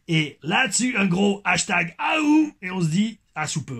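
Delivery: high, slow mock Québécois accent. Reading the end of a fan's comment, Québécois accent. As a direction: draw out the sign-off in put-on Québécois. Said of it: "Mon accent québécois".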